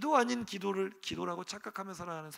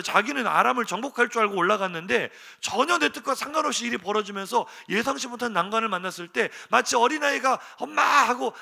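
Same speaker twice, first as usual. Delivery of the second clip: higher, louder fervent preaching; 7 semitones higher, 10 dB louder than usual. Acted. Preacher to congregation, fervent loud preaching. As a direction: preach it out with full force.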